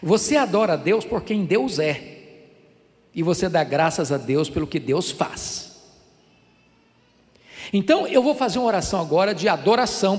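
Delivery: usual speaking voice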